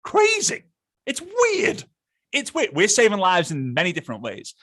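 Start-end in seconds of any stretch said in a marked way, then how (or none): 0.0-2.7 very high-pitched, emphatic exclamations; exaggerated disbelief. Real, not acted. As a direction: exclaim it in squeaky amazement. Hammers home how weird it is.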